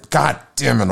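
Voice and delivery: exasperated self-directed curse; exasperated outburst. Real, not acted. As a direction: snap in frustration at your own flub. Flubs his words, curses himself.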